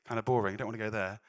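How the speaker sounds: ordinary talk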